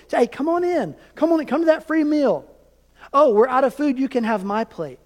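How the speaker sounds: high, bright, eager welcome; mock-eager church greeter calling everyone in. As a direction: invite people in like an overjoyed host.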